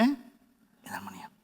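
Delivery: low, slow whisper; whispered answer. Shown as a demonstration. Pastor whispering the answer to his congregation. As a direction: breathe the answer softly. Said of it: "¿qué?".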